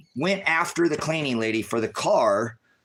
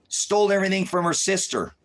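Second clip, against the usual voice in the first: ordinary talk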